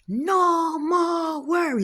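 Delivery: very high, slow chanting repeat; repeating the cook. Retold or imitated. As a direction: echo the blessing slowly and high. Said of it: "No, you say".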